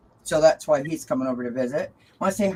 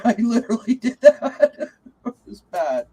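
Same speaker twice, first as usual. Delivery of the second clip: higher, louder, laughing through the words; 5 semitones higher, 4 dB louder than usual. Real, not acted. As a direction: crack up as you say it. Laughing at fallen cards.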